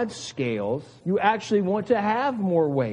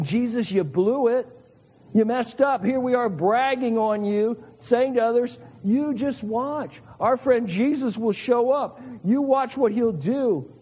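accusatory rebuke; 6 semitones higher than usual. Retold or imitated. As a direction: let him have it with both barrels. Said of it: "Martha is quite accusatory here".

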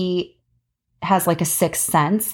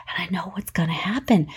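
whisper; 2 semitones lower, 5 dB quieter than usual. Real, not acted. Dreading in-laws' visit aside.

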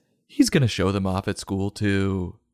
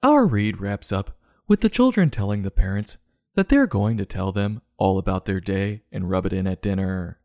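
ordinary delivery

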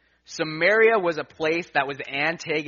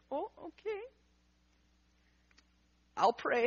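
high, very soft, heartbroken; broken voice; 13 semitones higher, 10 dB quieter than usual. Retold or imitated. Wife hearing they might lose the dog, in a broken high whisper-soft voice. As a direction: sound like the news just broke her. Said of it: "the most broken voice you can imagine Hannah".

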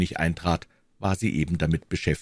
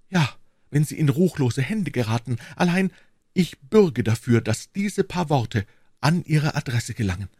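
put-on higher-pitched earnest young woman; devoted young servant woman; 8 semitones higher than usual. Young servant pledges herself to her queen, earnest and devoted.

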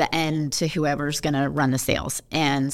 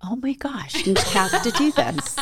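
laughing; 8 semitones higher than usual. Real, not acted. Reacting to sister's teasing question.

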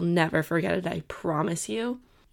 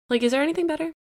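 excited question; 9 semitones higher, much faster than usual. Real, not acted. Rhetorical gushing question.

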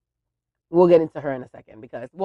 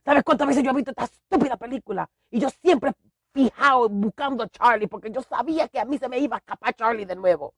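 a hint of a fed-up father, a bit higher and fast; lightly voiced exasperated dad; 8 semitones higher than usual. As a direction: rant like the frustrated dad. Dad ranting in quoted story.

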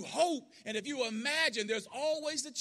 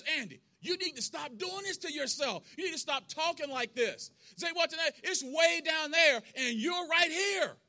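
raised, high-pitched exasperated pleading; acted heated scolding; 6 semitones higher, 5 dB louder than usual. Red urges Andy to stop dreaming, heated scolding.

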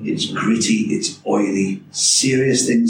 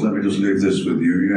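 Bill Nighy voice. Retold or imitated. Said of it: "You need Bill Nye to".

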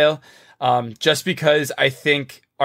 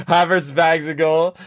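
laughing; 5 semitones higher than usual. Real, not acted. Laughs after joking hat trick.

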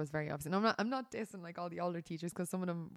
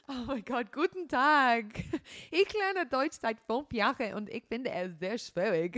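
high, loud, slow, laughing schoolbook German; tipsy tourist speaking schoolbook German to a stranger, excited; 4 semitones higher, 7 dB louder, slower than usual. Retold or imitated. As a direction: gush in German at a stranger after a couple of beers. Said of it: "I'd be like".

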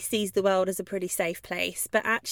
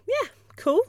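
much higher, breezy yeah cool; a carefree dog shrugging it off; relaxed dog; 13 semitones higher than usual. Pretend. Calm dog meeting another dog, high breezy. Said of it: "one dog is gonna go".

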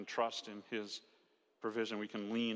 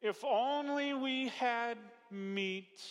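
high, slow whining complaint; moaning Israelite voice, drawn out; whiny Israelites; 10 semitones higher, much slower than usual. Retold or imitated. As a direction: whine like a hungry grumbling crowd. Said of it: "they say things like this".

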